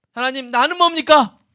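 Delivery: high plaintive cry with the weight on 뭡니까; self-pitying lament. Pretend. Pastor voicing a self-obsessed believer crying to God, plaintive and high. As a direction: wail 하나님, 나는 뭡니까 and lean hard on 뭡니까.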